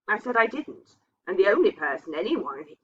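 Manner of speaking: plain reading voice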